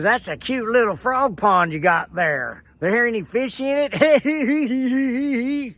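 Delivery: slow, sneering, rising high at the end; hammed-up condescending county man; mock snide official. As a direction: drawl it out like a smug bureaucrat. Mimics man's snide question.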